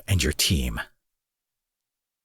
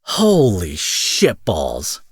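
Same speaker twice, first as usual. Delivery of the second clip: slow, drawn-out amazement; stunned disbelief; 2 semitones lower, much slower than usual. Acted. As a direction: stretch it out, stunned. Villain stunned by hero's belief.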